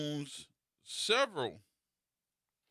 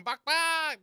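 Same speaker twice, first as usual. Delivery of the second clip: high mock chicken clucks; playful clucking, much higher; bird clucking; 13 semitones higher than usual. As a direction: cluck it like a strutting hen. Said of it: "just going".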